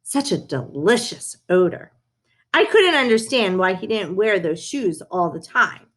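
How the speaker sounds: usual speaking voice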